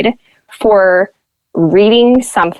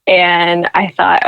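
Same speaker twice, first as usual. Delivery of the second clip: ordinary delivery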